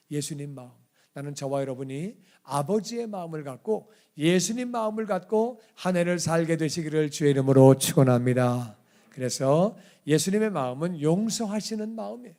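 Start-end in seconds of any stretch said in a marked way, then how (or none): none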